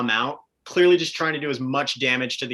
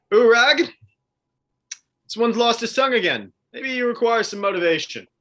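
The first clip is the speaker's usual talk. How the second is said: higher-pitched, theatrical veiled threat; Tristan, mock-musing menace; 10 semitones higher, 5 dB louder than usual. Tristan prompting Urag about a silent captive, raised theatrical menace. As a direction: call your companion over and muse menacingly.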